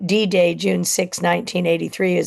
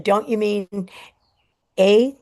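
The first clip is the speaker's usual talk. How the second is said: higher, stammering as tears come; choking up; 4 semitones higher than usual. Retold or imitated. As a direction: struggle to get the word out. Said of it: "couldn't even get the words out of my mouth before I started crying".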